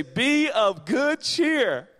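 very slow, high, drawn-out holler; shouting Jesus. Acted. Jesus calls out while all hell breaks loose, hollering. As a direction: stretch every word into a big shout. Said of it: "the audacity to say".

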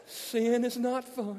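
slow, high mock preacher intoning; pastor voice. Preacher warns young Christians about sin, mock pastor voice. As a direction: draw it out like a hammed-up pastor. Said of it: "pastors that had pastor voice".

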